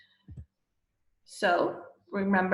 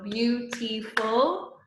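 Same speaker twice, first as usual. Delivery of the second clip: beautiful slow, syllables spaced; syllable count; slower than usual. Shown as a demonstration. Teacher to class, counting syllables slowly. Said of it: "that's three".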